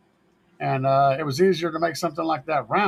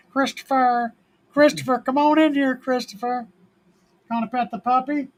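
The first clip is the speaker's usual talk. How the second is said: high-pitched sing-out to someone nearby; coaxing call; 9 semitones higher than usual. Real, not acted. Calling Christopher in to pet the puppy, coaxing. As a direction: coax them over in a high, sweet voice.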